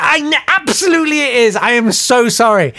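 high-pitched, desperate agreement; re-enacted frantic apology; grovelling. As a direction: concede everything in a panic. Grovelling to angry victim.

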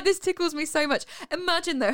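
ordinary talk, nothing marked

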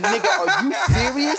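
can't believe the cop is serious; incredulous. Retold or imitated. Answering the cop who ticketed him, incredulous high outburst.

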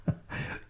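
soft, short chuckle at oneself; self-amused laugh. Real, not acted. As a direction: chuckle quietly at your own words. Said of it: "I'm laughing at myself".

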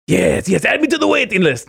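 high eager Watto voice. Retold or imitated. Begging the waiter to add him to the list in Watto's voice. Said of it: "put on the Watto voice".

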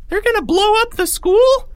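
very high, loud panicked squeal. A narrator's reading. Third grader at the back alarmed, squealing. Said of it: "squealed a voice at the back".

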